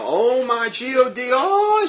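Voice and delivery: high, stretched, panicked "Oh my God"; mimicking her scream. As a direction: act out a hysterical drama-queen scream. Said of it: "she was screaming".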